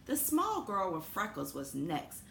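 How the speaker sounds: ordinary delivery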